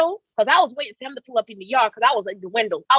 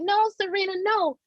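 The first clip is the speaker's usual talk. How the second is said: higher pleading mama voice; 8 semitones higher, 6 dB quieter than usual. Retold or imitated. Mother begging daughter not to shoot, pleading high. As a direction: beg her daughter to stop, pitched up. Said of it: "My mama was like".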